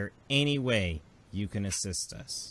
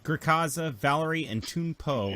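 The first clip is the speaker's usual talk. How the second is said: normal talking voice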